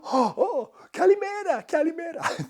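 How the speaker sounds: very high, loud delighted kalimera; high, loud, overjoyed reply of kalimera; thrilled greeting. Retold or imitated. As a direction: squeal kalimera back like a thrilled local. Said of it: "they go".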